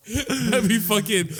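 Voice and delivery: high laughing riff. Real, not acted. Laughing along with a friend's joke. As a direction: laugh while you talk.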